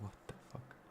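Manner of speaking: breathless whispered disbelief; stunned whisper. Real, not acted. Streamer to chat after his watch list vanishes, whispered shock. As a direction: barely voice it, in shock.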